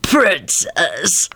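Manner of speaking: slow, mumbled croon of a hag; soothing hag mumble. A narrator's reading. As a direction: mumble fondly like an old hag. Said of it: "the hag mumbled soothingly".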